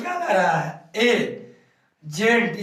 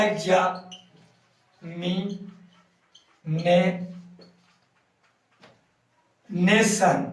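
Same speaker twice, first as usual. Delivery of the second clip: Examination in very slow pieces with long pauses; slow, separated syllables of examination; broken into syllables; 3 semitones lower, 3 dB quieter, much slower than usual. Shown as a demonstration. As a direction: dictate एग्जा, मि, ने, then nation. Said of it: "तोड़ेंगे तो क्या होगा".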